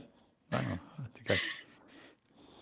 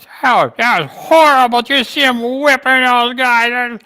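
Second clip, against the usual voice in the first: high, slow mocking old man; mocking Biden; 12 semitones higher, 6 dB louder, slower than usual. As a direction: imitate a whiny Biden, slow.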